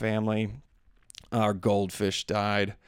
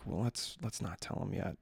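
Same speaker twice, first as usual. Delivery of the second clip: much softer, low-key wife voice; hushed wife; 3 semitones lower, 13 dB quieter than usual. Retold or imitated. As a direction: keep it down so the kids won't hear. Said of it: "And she was like".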